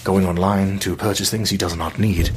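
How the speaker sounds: hushed wildlife-film commentary, lightly done; nature documentary narrator. Retold or imitated. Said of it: "like a David Attenborough kind of thing".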